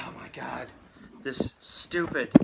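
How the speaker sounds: slow, punched-out irritation; exasperated. Real, not acted. Streamer fed up with a tray that keeps bumping, exasperated. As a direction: grumble with real annoyance, word by word.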